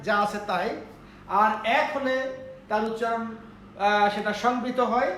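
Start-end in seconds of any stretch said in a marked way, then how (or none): none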